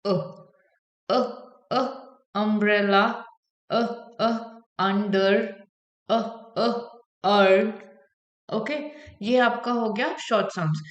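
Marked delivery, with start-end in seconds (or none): 0.1-7.7 short अ, अ as "uh, uh" before each example word; short U sound. Shown as a demonstration. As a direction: say अ in separate beats, then अम्ब्रेला. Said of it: "फिर यू की sound होती है".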